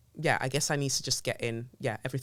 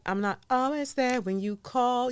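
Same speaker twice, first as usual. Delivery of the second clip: high, sing-song self-defence; lightly playing a breezy professional; mock sing-song; 8 semitones higher than usual. Excusing herself to clients in a high, breezy voice.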